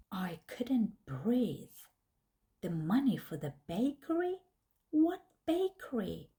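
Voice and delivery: ordinary delivery, nothing marked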